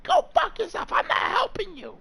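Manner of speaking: very high, squeaky mock of the sister; high mocking voice of an angry sister. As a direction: mimic her in a shrill falsetto. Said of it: "And she's like".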